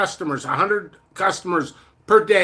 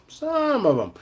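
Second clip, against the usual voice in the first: "Some of 'em" pitched higher; higher repeat; 5 semitones higher, 4 dB quieter than usual. Shown as a demonstration. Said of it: "Some of 'em".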